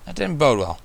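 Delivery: plain reading voice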